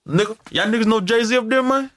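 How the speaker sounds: high-pitched eager stranger; imitated out-of-towner. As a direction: ask it high, like a star-struck local. Voicing out-of-towner asking about [name].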